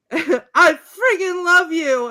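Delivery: high, loud, drawn-out love cry; mock passionate yell. Fan quoting lovers' declarations, yelling passionately. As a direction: belt out the declaration slowly.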